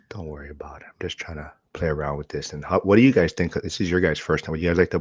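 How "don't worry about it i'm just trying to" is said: breathy aside under the breath; whisper. Real, not acted. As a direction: whisper it off to the side. Aside while host adjusts camera.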